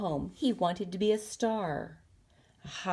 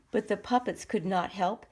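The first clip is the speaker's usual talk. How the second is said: usual speaking voice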